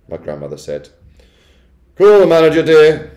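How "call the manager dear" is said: high, very loud command; affronted, loud and bossy; imperious Missus Jenkins. A narrator's reading. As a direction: summon help in a high, haughty voice. Wife demanding the manager.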